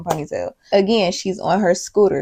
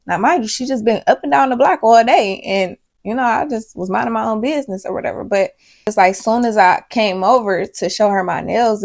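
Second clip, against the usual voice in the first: ordinary delivery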